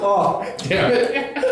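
slow words through high laughter; laughing while talking. Real, not acted. Laughing at the bland apple.

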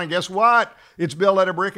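excited. Acted. Story climax, built of brick.

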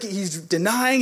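ordinary talk

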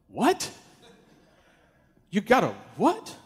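a startled double take; stunned what. Retold or imitated.

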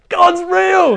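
very high, loud cry of joy; joyful echo. Retold or imitated. Friend echoing that God is real, overjoyed. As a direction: fling it up high with delight.

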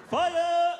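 high, loud, laughing cry; playful high-pitched holler; mock preacher's shout. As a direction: burst out with a comic revival yell. Sudden preacher's outburst amid laughter.

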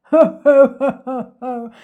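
loud villain belly laugh; booming, acted ho-ho-ho; gloating laugh. A narrator's reading. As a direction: laugh like a wicked crocodile. Gloating crocodile laughs aloud.

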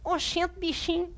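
a puppet's high Bahian "Oxente, bichinho"; Bahian accent. Acted. Puppet Mel answers the pastor with a Bahian greeting. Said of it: "O que você vai falar".

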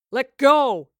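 shouted over roaring waves; bellowing. A narrator's reading. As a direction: bellow it with full force. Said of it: "he bellowed above the roaring".

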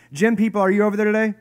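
loud, high, quick call across the room. Real, not acted. Preacher hailing the gym people, calling out loudly. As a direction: hail a group at a distance.

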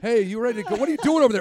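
loud, high call across the room. Retold or imitated. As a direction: shout over to a friend. Friend calling to bathroom stall.

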